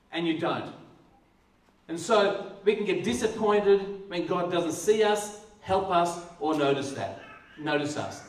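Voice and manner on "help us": ordinary delivery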